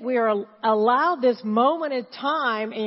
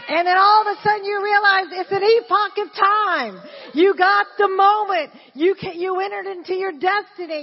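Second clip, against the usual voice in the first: rising preaching fervour; 8 semitones higher, 7 dB louder than usual. Real, not acted. Preaching climax.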